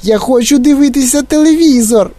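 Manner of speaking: put-on very high squeaky child voice; squealing tantrum. A narrator's reading. Shrunken boy demanding television, squealing. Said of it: "пищав він".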